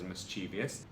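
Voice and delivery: "mischievous" as mis-CHEE-vee-us; low, casual "mischievous" with an extra ee before -us; own four-syllable mischievous. Real, not acted. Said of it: "I've always pronounced this word as".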